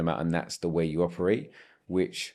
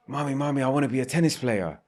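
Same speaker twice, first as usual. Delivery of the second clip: put-on higher child voice begging a parent; eager child; 6 semitones higher than usual. Child begging mommy to be a tennis player, higher eager voice. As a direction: play the kid pleading mommy for a dream. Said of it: "they're like".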